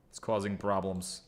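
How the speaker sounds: ordinary talk, nothing marked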